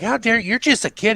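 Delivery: high-pitched mock outrage; retold freak-out. Retelling the girls' reply to the boy, mock offended. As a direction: imitate girls scandalized by a kid. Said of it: "they freak out on him".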